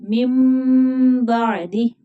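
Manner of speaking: drawn-out मिम बादी with ikhfa; tajweed demo. Shown as a demonstration. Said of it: "इख्फा होगा".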